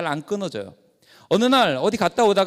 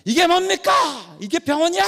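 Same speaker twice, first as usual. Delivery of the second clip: much higher and louder, shouting at the whole ward; furious shout; 10 semitones higher, 4 dB louder than usual. Retold or imitated. Pastor shouting at the hospital staff, furious yell. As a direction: let the rage burst out loud. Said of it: "막 큰 소리 치니까".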